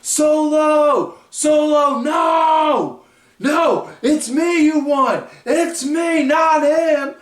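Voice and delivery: high, loud desperate yelling, pleading; imitating yelling. Cody yelling at Solo to take him instead, high desperate shout. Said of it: "you hear Cody yelling".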